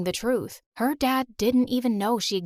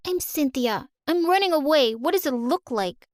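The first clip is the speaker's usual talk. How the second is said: put-on sassy little girl; 6 semitones higher, 3 dB louder than usual. Child character voiced.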